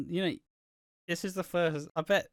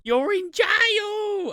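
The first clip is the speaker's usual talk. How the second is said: shrill mock mum screaming, slow and much higher; 6 dB louder, much slower than usual. Mum in viral Monopoly video screaming at her son, much higher slow shout. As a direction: screech it out like a furious mum at Monopoly. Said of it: "the mum who's like".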